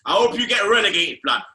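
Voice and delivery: loud, fast jeering rant; angry shout. Real, not acted. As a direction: shout it at the rival club. Angry rant at rival club.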